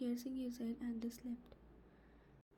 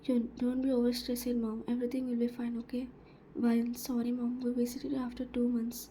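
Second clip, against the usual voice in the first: much louder, forceful reading; 9 dB louder than usual. Acted. Narrator reading the family visit, loud. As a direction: read this stretch out loud and strong.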